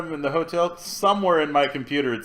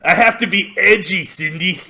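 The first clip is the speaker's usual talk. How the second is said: hammed-up edgy boast; mock edgy; 5 semitones higher, 5 dB louder than usual. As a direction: play it overly edgy.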